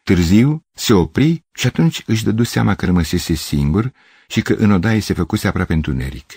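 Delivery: ordinary delivery, nothing marked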